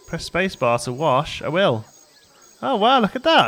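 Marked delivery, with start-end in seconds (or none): 2.6-3.5 excited. Real, not acted. Amazed by the hippo game.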